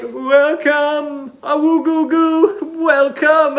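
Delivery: high, slow, sing-song mockery of a bad performance; mocking bad singer. Streamer mocks a bad Broadway performance, high and hammy. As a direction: camp it up, squeal the welcome like a terrible cabaret MC. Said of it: "He's like".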